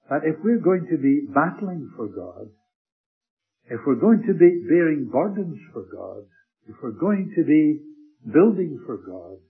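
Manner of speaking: slow, loud rising triplet; fervent preacher stacking phrases; preaching build. Acted. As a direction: build each clause like a pulpit crescendo.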